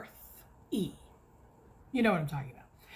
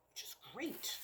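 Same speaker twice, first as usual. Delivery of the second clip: whispered aside; 13 dB quieter than usual. Real, not acted. Sarcastic aside about wax.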